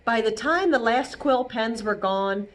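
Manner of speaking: gentle read-aloud narration; storybook reading. A narrator's reading. Reading Elmore's story aloud to children, gently. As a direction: tell it like a bedtime story. Reading picture book to kids.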